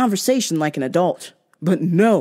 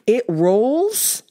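incredulous; 3 semitones higher than usual. Real, not acted. Incredulous echo of excuse.

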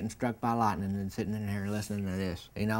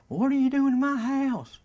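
put-on much higher and louder, a befuddled old man; confused old man; 8 dB louder than usual. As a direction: demand it high and loud like a confused old man. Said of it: "And he said".

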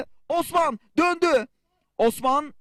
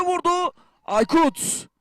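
ordinary talk, nothing marked

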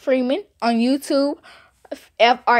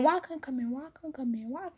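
hushed, fading self-questioning; muttering to self; 13 dB quieter than usual. Retold or imitated. Walking off wondering why she was left out, muttering. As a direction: mutter it under your breath while walking. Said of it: "I was walking".

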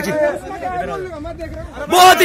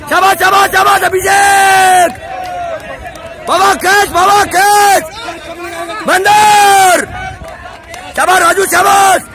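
coach's shouted cheering; 5 semitones higher, slower than usual. Real, not acted. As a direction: keep yelling praise to drive the team on. Coach shouting encouragement over training. (ज़ोर से हौसला बढ़ाती coach की पुकार)

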